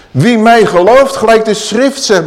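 proclaiming. Acted. Preacher repeats the verse with force.

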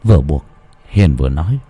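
horror story narration. A narrator's reading. Narrator telling listeners the creepy scene, in a dark storytelling voice. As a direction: read it like a spooky tale by night.

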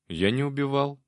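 ordinary delivery

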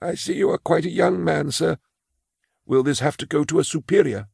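put-on high, timid old clerk voice; a frail old clerk confessing his fear; old man Imus. Imus asks about superior.

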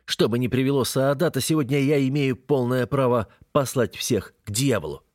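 ordinary delivery

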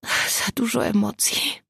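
slow, halting whisper; stammered whisper. Acted. Exhausted Agata stammering to her friends in a whisper. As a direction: barely get the words out. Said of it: "wydukała Agata".

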